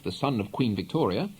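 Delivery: ordinary delivery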